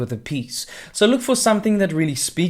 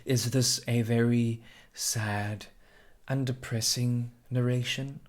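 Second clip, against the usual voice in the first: slow and softer, sad and depressing; sad tone demo; 4 semitones lower, 6 dB quieter than usual. Shown as a demonstration. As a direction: let the voice sink sadly. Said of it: "the emotion or the color of the piece".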